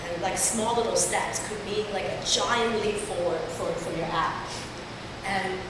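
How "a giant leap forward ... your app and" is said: ordinary delivery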